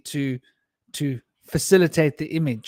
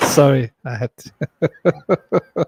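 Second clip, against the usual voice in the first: laughing; 5 dB louder, much slower than usual. Real, not acted. Laughing apology after goodnights.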